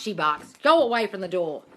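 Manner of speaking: ordinary talk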